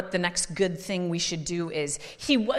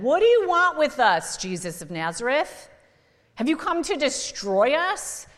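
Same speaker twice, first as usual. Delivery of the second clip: a preacher's mock demon cry, higher and louder; 5 semitones higher, 4 dB louder than usual. Preacher voicing demons crying out at Jesus, mock cry. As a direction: cry out like a possessed man. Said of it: "the demons cried out and said".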